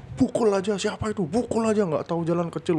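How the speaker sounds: high mock angry crowd yell; furious neighbours. Neighbours raging at the reckless driver, mock angry yell. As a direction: rage like an angry mob. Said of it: "ngamuk lah intinya orang-orang".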